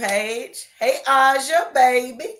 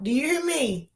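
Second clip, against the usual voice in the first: ordinary talk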